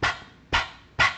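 short popped p, lips only; quick, soft lip pops; small, light p pops; popping p. Shown as a demonstration. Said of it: "like a popping sound".

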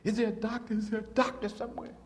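high, urgent imitation of a woman calling for a doctor; retold scream. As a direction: cry out for help up high, at normal volume. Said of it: "and she screamed".

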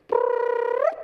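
high loud imitated police whistle; whistle blast. Officer stops traffic, loud whistle imitation. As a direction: blow the whistle sound.

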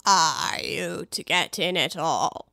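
very low, slow, indifferent drawl; a bored servant, low and slow; slow deep footman. Acted. Footman voice held.

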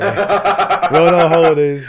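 high, loud and slow with a laugh; hammed-up holiday lad voice.

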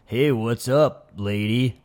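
slow sleazy greeting; creepy come-on voice. Retold or imitated. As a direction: lean in and creep it out slowly. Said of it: "be like".

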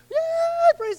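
a healed man losing it with joy, near crying; freaking out. Retold or imitated. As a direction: ecstatic high yelp. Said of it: "He's freaking out".

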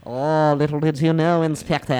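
gloating cartoon villain; mustache twirling villain. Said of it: "this French inspector".

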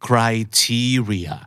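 "criteria" slowly, TE stressed, then falling; drawn-out model of cri-TE-ri-a; stress on second syllable. Shown as a demonstration. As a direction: lean on the TE in "criteria". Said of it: "เน้นที่พยางค์".

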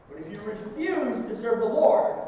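ordinary talk, nothing marked

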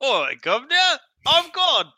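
high mock Cockney, Cockney God booming. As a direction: put on a cheeky Cockney God. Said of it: "Can you imagine a Cockney God?".

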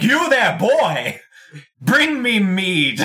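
booming, slow, high barbarian call. Pretend. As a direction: bellow it like a brutish warrior demanding ale. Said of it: "he's like".

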